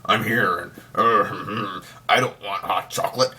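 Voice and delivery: hammed-up gravelly grumble with growled hums; grumbliest voice. Host mocking a hunter who is no villain, grumbly voice. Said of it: "the most grumbliest voice".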